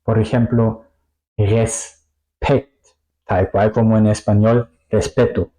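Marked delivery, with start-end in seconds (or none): 1.4-2.8 "respect" in two parts, res-pekt, plain S; S and P separate. Shown as a demonstration. Teacher showing SP inside a word, "respect" pronounced separately. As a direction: say "respect" with a hissed S, pause, then P. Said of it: "se pronuncia separado".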